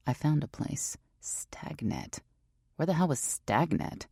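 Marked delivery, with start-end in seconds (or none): 1.2-2.2 whispering the name to herself; whispered. A narrator's reading. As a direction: breathe the word out, reading.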